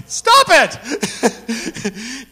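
high, loud playful outcry; mock protest. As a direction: cry out in comic objection, laughing around it. Mock protest at her remark.

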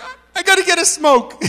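high, loud stammered announcement; overexcited blurting. Retold or imitated. Woman rushing off after her prayer, overexcited blurting. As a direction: imitate a frantic urge to dash off.

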